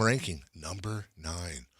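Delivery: murmured under his breath to himself; quiet aside. Real, not acted. Repeats ranking as aside.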